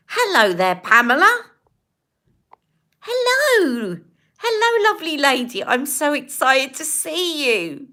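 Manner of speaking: high, loud, delighted hello; excited greeting. Real, not acted. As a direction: greet her like a long-lost friend. Excited greeting to returning viewer.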